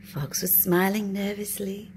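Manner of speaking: ordinary delivery, nothing marked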